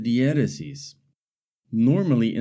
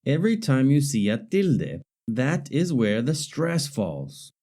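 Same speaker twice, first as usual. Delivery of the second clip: ordinary talk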